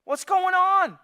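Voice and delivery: high, loud call to the servant; loud demand. Retold or imitated. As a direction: shout to the servant for answers. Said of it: "he's like".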